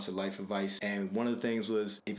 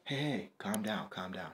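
mock soothing; 4 semitones lower, 6 dB quieter than usual. Someone trying to settle an upset partner, mock soothing. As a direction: ease it down softly, trailing quieter. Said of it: "talking to somebody about something, they're like".

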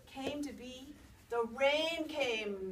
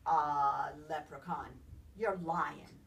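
lower, mock-gruff grumble; Nancy grumbling; 7 semitones lower, slower than usual. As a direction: accuse him in a low growl. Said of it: "grumbled Nancy".

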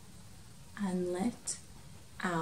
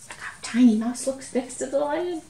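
usual speaking voice

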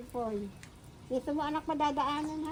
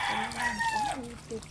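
excited. Real, not acted. Drawn out pleased.

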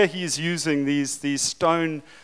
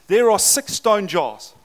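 loud, higher, slow and deliberate; commanding declaration; 4 semitones higher, 7 dB louder, slower than usual. Acted. Preacher voicing Jesus to the servants, commanding. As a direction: announce it as a firm instruction.

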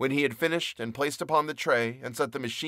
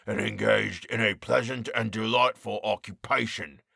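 jeering croak; 2 semitones lower than usual. A narrator's reading. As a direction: sneer the order down at him. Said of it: "The jeering mockery of those tones".

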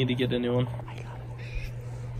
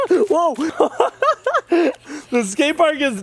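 ordinary talk, nothing marked

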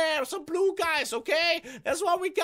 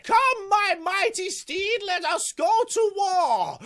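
slow, grand warrior rallying cry; mock-heroic warrior; slower than usual. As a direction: declaim slowly like a hero riding to war. Playing a warrior addressing his steed.

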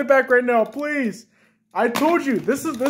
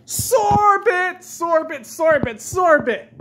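excited calling; 7 semitones higher, much slower than usual. Acted. Child calling out for sorbet.

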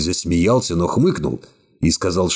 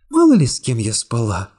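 put-on lightly raised young woman voice, cool and calm; young woman's voice; 5 semitones higher than usual. A narrator's reading. Secretary coolly refusing the hero's embrace, high young woman voice. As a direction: voice her higher, calmly distant. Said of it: "улыбнувшись очень цинично".

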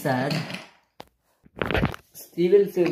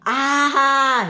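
high, loud, long "aah"; falling scream; 11 semitones higher, 6 dB louder than usual. Acted. Flying Scotsman splashing into the sea, high scream.

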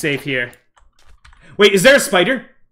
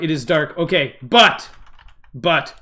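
normal talking voice

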